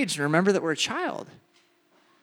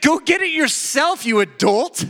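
high, loud brush-off; scoffing retort; 7 semitones higher, 5 dB louder than usual. Retold or imitated. You brush off a roommate demanding water, loud scoffing. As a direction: toss it back with scorn.

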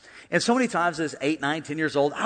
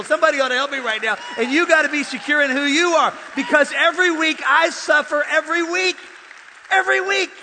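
higher, emphatic sermon delivery; impassioned preaching; 8 semitones higher, 5 dB louder than usual. Real, not acted. Preacher urging congregation to stand up to bullies, impassioned. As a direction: drive each point home to the crowd.

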